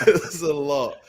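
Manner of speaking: high breathless laugh into words; real giggle over the comments. Real, not acted.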